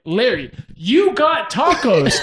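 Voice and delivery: loud, laughing mock argument; shouting at a drunk friend through laughter; heated argument. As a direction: argue it out, half laughing. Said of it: "a heated argument".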